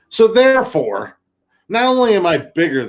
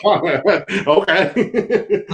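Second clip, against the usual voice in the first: laughing; 4 semitones higher than usual. Real, not acted. Laughing reply to the rabbit.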